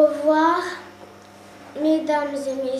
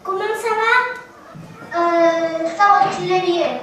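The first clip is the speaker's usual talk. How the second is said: calling out; 3 semitones higher, 8 dB louder than usual. Real, not acted. Children greet pupils on screen.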